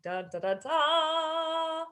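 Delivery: ordinary talk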